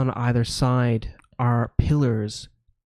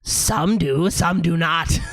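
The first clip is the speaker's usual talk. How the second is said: higher, teasing gargoyle voice; 5 semitones higher, slower than usual. Acted. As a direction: toy with them like a riddling gargoyle. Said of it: "one says".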